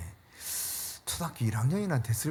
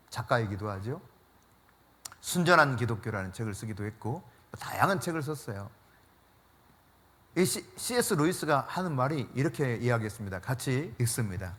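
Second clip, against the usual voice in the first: normal talking voice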